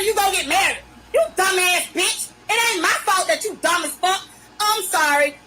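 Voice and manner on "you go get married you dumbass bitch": usual speaking voice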